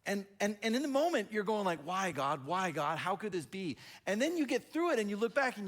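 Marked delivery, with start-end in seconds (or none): 1.8-3.8 louder, acted cry of why; retold protest to God, pushed louder; anguished pleading. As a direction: plead upward in mock despair. Acting out anguished question to God.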